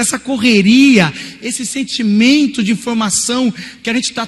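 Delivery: high, loud fervent preaching; loud preaching climax. Real, not acted. Preacher crying out to the church about anxiety, loud and high. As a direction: drive the point home to the congregation.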